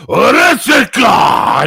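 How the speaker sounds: slow, high, very loud barked battle cry; mock masculine wrestler shout. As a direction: bellow it like a fierce wrestler. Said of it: "allowed to speak like a man".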